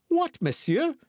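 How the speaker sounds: very high, indignant exclaimed question; crying out. A narrator's reading. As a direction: burst out in protest, voice leaping up. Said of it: "cried D'Artagnan".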